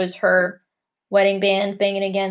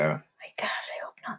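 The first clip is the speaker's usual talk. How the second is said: hushed, breathy whisper; whispered dread; 11 dB quieter than usual. Real, not acted. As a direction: whisper it in mock horror. Horrified aside about the name Pepe.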